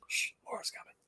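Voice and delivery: hushed ghostly whisper. Retold or imitated. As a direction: breathe it like a secret warning. Ghost voice on recording.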